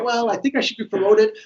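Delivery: mock a nervous upward question; a fidgety employee pitching for promotion while swinging the chair; rising intonation. Shown as a demonstration. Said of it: "if I'm talking and I'm swinging my chair".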